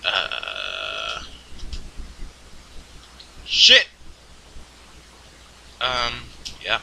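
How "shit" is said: sudden loud, high-pitched curse; one sharp yelp of a swear; real cursing outburst. Real, not acted. Player cursing at the game, sudden outburst.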